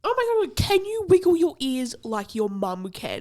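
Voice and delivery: put-on high, loud excited child voice; excited kid. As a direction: squeal it like an eager kid. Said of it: "one of the kids from my work".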